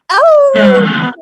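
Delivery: high, loud sudden "Oh!"; sharp pained cry. Real, not acted. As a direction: yelp as the pain hits. Grandma cries out in sudden pain.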